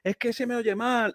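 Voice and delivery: high, slow, distant put-on voice of a worried caller; imitating a colleague whining that she can't be heard; mock complaining caller. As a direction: play the coworker who sounds bad on the phone, pitched high and dragged out. Caller complaining about bad audio.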